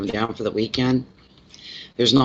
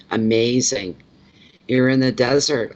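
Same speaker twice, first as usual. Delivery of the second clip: ordinary delivery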